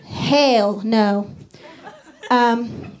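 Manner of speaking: usual speaking voice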